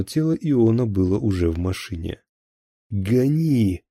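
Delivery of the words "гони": higher-pitched urgent command; raised, commanding call to the driver; order. A narrator's reading. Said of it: "приказал он Шувалову".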